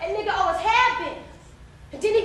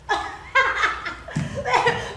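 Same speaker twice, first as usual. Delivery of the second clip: scornful laugh; 3 semitones higher than usual. Acted. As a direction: burst out laughing. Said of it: "Crystal burst out laughing".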